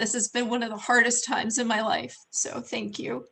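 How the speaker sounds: holding back tears while thanking viewers; getting emotional. Real, not acted. Host thanks viewers for support, voice tearful and breaking. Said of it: "Sorry, I'm getting emotional".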